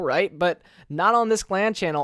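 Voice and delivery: ordinary talk, nothing marked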